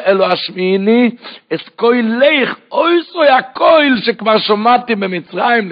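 loud, high-pitched impassioned sermon; preaching at full force. Acted. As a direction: drive the verses home at the top of your voice. (דרשה נלהבת בקול רם)

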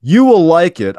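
high, loud poetic declaration; spoken word. Pretend. Podcast host resumes reading lyrics as loud mock spoken word. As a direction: proclaim it like a slam poet. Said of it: "this spoken word segment".

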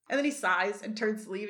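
ordinary delivery

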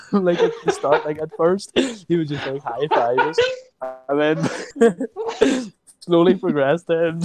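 high, giggly storytelling; laughing while talking. Real, not acted. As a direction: tell it while cracking up. Breaks up laughing while retelling.

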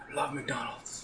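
put-on soft childlike whisper. Brother whispers back longingly, hushed. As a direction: breathe it out, wistful.

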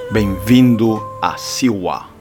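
ordinary delivery

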